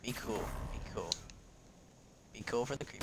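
hushed, low self-soothing; tense whisper. Real, not acted. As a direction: whisper it to steady yourself. Calming self, hiding from infected.

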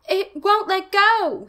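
very high, loud and slow strained plea; panicked. Acted. Casey telling Margaret he is trapped, high slow panic. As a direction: wail it out in desperation.

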